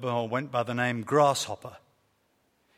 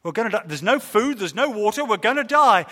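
higher, louder, building panic; shouting in rising terror, louder each line; panicked yell; 5 semitones higher, 5 dB louder than usual. Acted. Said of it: "he panicked".

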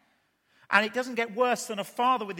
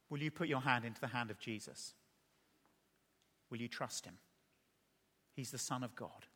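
much softer, lower, with long pauses; hushed, low closing question; solemn appeal; 6 semitones lower, 10 dB quieter than usual. Real, not acted. Preacher asking the congregation about trusting Jesus, solemn and quiet.

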